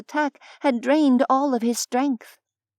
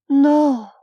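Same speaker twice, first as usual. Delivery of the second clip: louder, drawn-out protest; distressed denial; 3 semitones higher, 6 dB louder than usual. A narrator's reading. Sidhe hurries to the fallen Fae, distressed denial. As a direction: protest in dismay.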